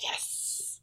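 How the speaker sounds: long, hissed whispered cheer; very soft, voiceless retold victory; whispered triumph. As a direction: celebrate secretly under your breath. Retold private triumph at invitation.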